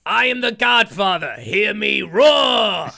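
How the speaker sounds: mock godfather roaring his line; hammed-up roar. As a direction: bellow it like a proud lion king.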